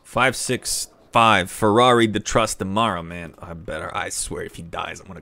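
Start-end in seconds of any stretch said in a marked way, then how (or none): 0.0-3.0 loud proclamation. Real, not acted. Proclaiming heir's name.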